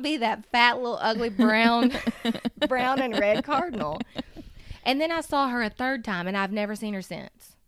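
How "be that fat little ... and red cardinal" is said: real laughter breaking into the words; laughing while talking. Real, not acted. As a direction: crack up mid-sentence, a bit louder. Laughs at the ugly bird.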